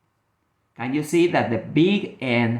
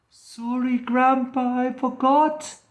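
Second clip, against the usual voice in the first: put-on sorry little girl; 9 semitones higher than usual. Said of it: "she said".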